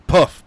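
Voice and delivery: spoken puff effect; mouth puff sound effect. Acted. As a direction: pop it like a vanishing.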